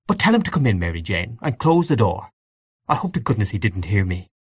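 plain reading voice

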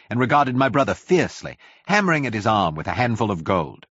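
usual speaking voice

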